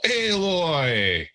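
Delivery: calling a name out high across the camp; big call. Acted. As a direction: shout her name from a distance. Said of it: "there's a big call".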